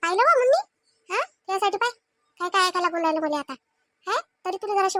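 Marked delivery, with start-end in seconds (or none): 0.0-0.6 high, loud, indignant call to mom; indignant outcry. Acted. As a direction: cry out to mother in outrage. Wife complains loudly to her mother.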